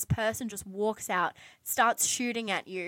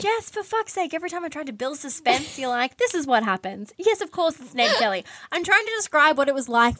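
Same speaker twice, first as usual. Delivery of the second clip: mock exasperated rant, higher and louder; venting at a friend who spoiled the suspense; 6 semitones higher, 5 dB louder than usual. Real, not acted. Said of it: "frustrated at friend spoiling story".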